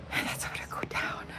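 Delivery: soft whispered aside; quiet conspiratorial whisper. Real, not acted. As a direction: whisper it under your breath.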